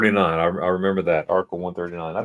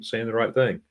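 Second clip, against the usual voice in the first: usual speaking voice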